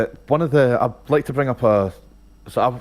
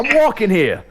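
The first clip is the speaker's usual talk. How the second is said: put-on higher, punchy New York accent; New Yorker impression; 8 semitones higher, 3 dB louder than usual. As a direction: bark it like a cabbie-hating New Yorker. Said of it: "an impression of a New Yorker".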